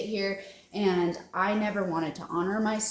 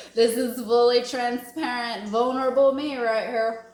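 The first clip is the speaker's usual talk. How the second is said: playful declaration; 4 semitones higher, 5 dB louder than usual. Real, not acted. Joking self-presentation.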